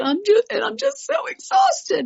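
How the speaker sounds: high, breaking tearful voice; crying. Retold or imitated. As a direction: sob out the exhaustion. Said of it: "I started crying and I said".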